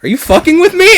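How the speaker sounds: high, loud, mock indignant demand; exasperated outburst. Demanding an answer from the moaning sleeper, exasperated outburst. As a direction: burst out, fed up with waiting. Escalated take after no answer.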